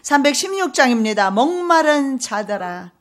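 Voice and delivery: loud, driving sermon delivery; fervent loud preaching; full-force preaching. Acted. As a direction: preach it hard to the whole church. Declaiming hymn lyrics at full force.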